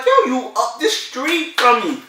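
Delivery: high, drawn-out incredulous realization; slow it down and pitch it up in disbelief; retold amazement. Retold amazement at being neighbours.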